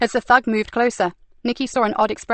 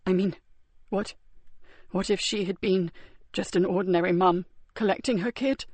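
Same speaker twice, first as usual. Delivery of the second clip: weak and wounded; 6 dB quieter than usual. A narrator's reading. Wounded man, soft.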